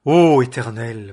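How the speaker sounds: loud, slow cry to God. A narrator's reading. Elijah crying out to God over the widow's dead son, loud slow cry. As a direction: call out to the Lord, drawn out and loud. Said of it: "Et il cria à l'Éternel et dit".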